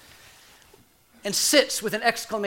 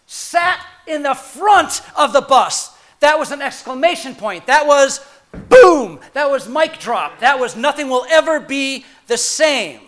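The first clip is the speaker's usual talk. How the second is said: preaching climax; 5 semitones higher, 10 dB louder than usual. Real, not acted. Preacher building to Rosa Parks climax.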